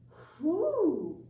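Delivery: who? called out loud and high; emphatic who. Shown as a demonstration. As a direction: announce the word who?. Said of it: "One W question is".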